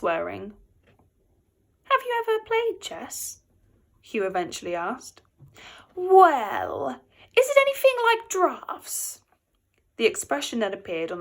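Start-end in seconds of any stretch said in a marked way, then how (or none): 1.8-3.4 Hugh's voice. A narrator's reading. Hugh asks, character voice. 5.9-9.2 Ivor's voice. A narrator's reading. Ivor answers, character voice.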